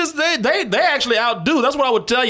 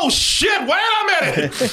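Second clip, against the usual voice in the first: surprised; 9 semitones higher, slower than usual. Real, not acted. Sudden realization while watching.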